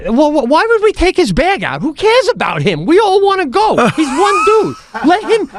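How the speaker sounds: high, exasperated plea; mock-indignant complaint in a high voice; indignant protest. Arguing with the flight attendant.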